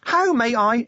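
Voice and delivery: a tiny eager machine-imp rattling off its greeting; chirpy imp. Acted. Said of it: "it began, speaking fast".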